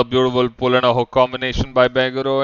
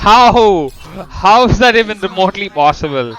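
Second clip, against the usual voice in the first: loud, high, incredulous outburst; can't believe what just happened; shocked disbelief; 7 semitones higher, 8 dB louder than usual. Real, not acted. Caster shocked by the result.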